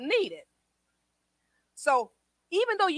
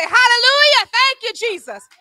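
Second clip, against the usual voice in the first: high, ringing shout of praise; loud praise; 9 semitones higher, 11 dB louder than usual. Acted. Preacher showing the praise people withhold, loud praise.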